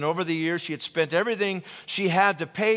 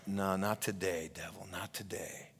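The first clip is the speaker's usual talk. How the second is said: defiant whisper; 8 semitones lower, 10 dB quieter than usual. Acted. Defying the devil in a sermon.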